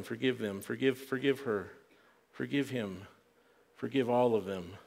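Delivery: hushed, low pleading, lightly acted; soft, weary intercession from a sufferer; pained plea. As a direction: quietly plead for each one, voice dropped. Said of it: "He's in this pain and agony".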